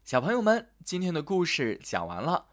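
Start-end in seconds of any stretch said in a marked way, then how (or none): none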